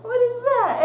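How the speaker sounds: rising panic at something in the mouth; panicked confusion. Retold or imitated. Student finding loose tooth in exam, panicked. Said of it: "I'm like".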